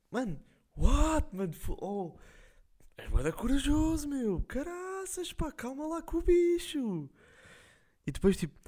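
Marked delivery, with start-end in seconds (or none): 0.1-7.1 high, slow, drawn-out shocked "what"; stunned disbelief. Retold or imitated. Guy reacting to a wild question, high and stunned. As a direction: play the guy gawking in disbelief, pitched way up. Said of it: "gajo, tipo".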